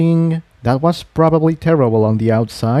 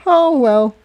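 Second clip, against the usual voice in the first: higher mare, light resigned sigh; Aurora's voice; 13 semitones higher than usual. A narrator's reading. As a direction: let it go with a shrug. Aurora shrugs it off.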